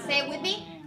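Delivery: normal talking voice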